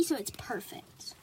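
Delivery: slow hushed whisper. Real, not acted. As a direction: breathe it softly and slowly. Soft comment while playing slime.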